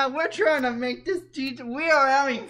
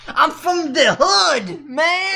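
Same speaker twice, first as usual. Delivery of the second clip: higher, slowed mock street voice; 4 semitones higher, slower than usual. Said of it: "talks like that?".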